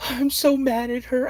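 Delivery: put-on high, pouting child's voice; upset little girl. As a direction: sulk like a cross second-grader. Daughter complaining.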